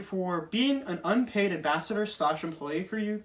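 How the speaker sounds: ordinary talk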